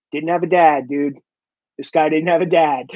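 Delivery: low, understated bouncer aside; bouncers' verdict. Acted. Bouncers commenting to each other on a rude customer, low dismissal. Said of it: "we would always go".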